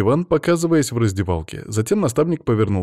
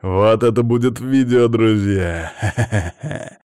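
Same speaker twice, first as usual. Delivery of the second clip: loud hyped announcement ending in laughter; gleeful vlogger; 3 semitones higher, 6 dB louder, slower than usual. A narrator's reading. Max filming on his phone for friends, gleeful. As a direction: announce it loudly to your viewers, then laugh.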